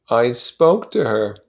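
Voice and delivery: plain reading voice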